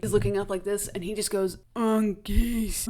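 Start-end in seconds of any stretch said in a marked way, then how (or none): 1.7-2.8 mild delirious moan; dazed moan. Retold or imitated. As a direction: mumble it half-conscious. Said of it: "kind of moaning".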